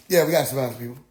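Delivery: low, level concession; understated agreement. Retold or imitated. Questioner conceding the preacher's point, low and matter-of-fact.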